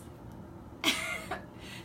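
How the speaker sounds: a sudden high silent-shaking giggle; high breathless giggle. Real, not acted. Laughing about her mother peeling off the stencil, airy giggle.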